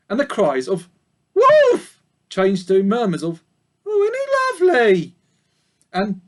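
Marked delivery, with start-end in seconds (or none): 1.3-2.0 very high, loud alarmed cry; sheep crying wolf. A narrator's reading. 3.8-5.1 high, louder cooing sheep; admiring sheep. A narrator's reading.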